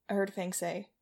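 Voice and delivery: usual speaking voice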